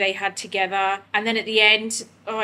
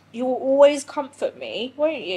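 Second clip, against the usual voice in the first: talking to the dog; 5 semitones higher, 6 dB louder than usual. Real, not acted. Cooing to her dog Nico.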